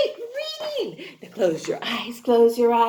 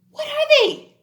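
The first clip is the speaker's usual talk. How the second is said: excited question; 12 semitones higher, 6 dB louder, faster than usual. Acted. Teacher quizzing kids eagerly.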